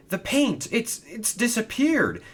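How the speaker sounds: very high, stammering dismay; alarmed engine finding the paint gone; panicked. A narrator's reading. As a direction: sputter it out in disbelief. Paint has vanished.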